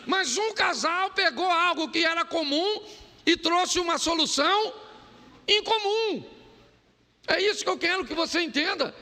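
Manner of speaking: high, ringing pulpit voice with rising urgency; fervent preaching. Acted. Preacher pressing the crowd on shared problems, fervent and ringing. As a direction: drive the point home to the congregation.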